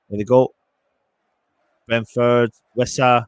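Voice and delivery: live play-by-play burst; excited commentary. Real, not acted. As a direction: call the counter attack with urgency. Counter attack in live commentary.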